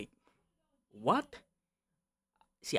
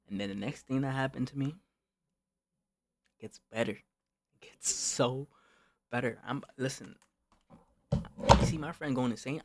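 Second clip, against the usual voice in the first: ordinary talk, nothing marked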